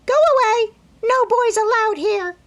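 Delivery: very high, loud parrot screech; shrill bird cry; parrot squawk. A narrator's reading. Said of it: "called out".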